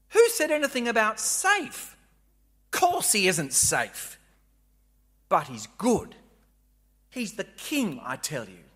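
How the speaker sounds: lisping buck-toothed beaver; lightly voiced gruff beaver; Mister Beaver. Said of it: "I'll do my best Mister Beaver impression".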